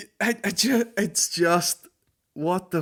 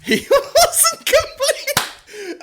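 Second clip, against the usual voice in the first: screaming the line through laughter, much higher and louder; shrieking laugh; 10 dB louder, much slower than usual. Pretend. Mocking the ad's punchline, shrieking with laughter.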